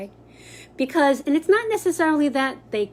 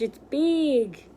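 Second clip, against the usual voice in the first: ordinary talk, nothing marked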